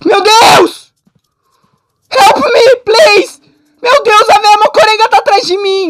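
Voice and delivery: panicked high shouting; crying out in real fear; being chased. Real, not acted. Chased by old woman.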